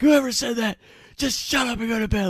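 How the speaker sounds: high, loud furious order; angry coach yell. Retold or imitated. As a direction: bark it at the tent like a livid coach. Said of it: "our coach is going crazy".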